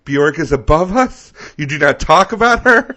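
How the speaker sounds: high, loud scolding impression; mock-indignant friend voice; imitating Ollie. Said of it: "Ollie would be like".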